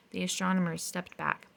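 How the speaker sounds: ordinary delivery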